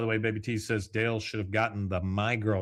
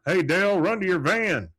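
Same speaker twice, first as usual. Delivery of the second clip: loud, higher-pitched mock shout; calling out; 9 semitones higher, 5 dB louder than usual. Hank calls to bee-chased Dale to run, shouting. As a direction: holler it across the yard.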